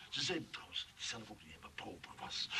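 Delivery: soft whisper, passing on nasty gossip. Acted. Johan whispering the staff's gossip to Clivia. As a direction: whisper it like a secret.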